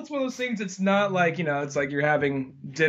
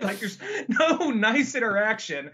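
ordinary talk, nothing marked

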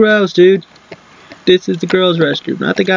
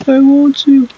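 louder, higher I want you; loud creepy call; 6 semitones higher, 5 dB louder than usual. Pretend. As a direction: call it out menacingly. Louder second take.

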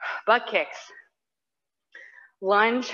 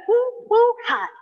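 high, loud whoop of encouragement; excited cheer; 12 semitones higher, 4 dB louder than usual. Real, not acted. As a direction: whoop them on, pitched way up. Coach cheering class on.

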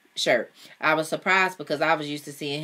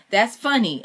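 higher, louder amused exclamation; 7 semitones higher, 5 dB louder than usual. A narrator's reading. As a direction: exclaim it as if tickled, with no actual laugh. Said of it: "I exclaimed, still laughing".

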